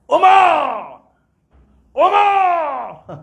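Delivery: high, loud screaming of the name; wife screaming. Retold or imitated. Umar's wife screams for her husband in front of a guest, shrill screaming. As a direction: shriek the husband's name from inside the house. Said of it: "bini Sayyidina Umar menjerit".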